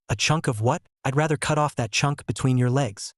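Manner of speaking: ordinary delivery, nothing marked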